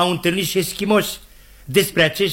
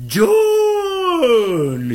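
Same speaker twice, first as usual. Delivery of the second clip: very high, long wailing call; hammed-up anguished call fading out; desperate cry; 3 dB louder than usual. Scott, overcome with grief, tries one last time, desperate wailing call. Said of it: "Copleșit de durere, Scott făcu o ultimă încercare".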